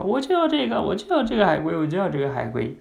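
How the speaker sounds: put-on very high child voice, easing down; high-pitched whining demand; insistent little daughter. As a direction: act a small girl's insistent tantrum. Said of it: "有的女儿呢，就会说".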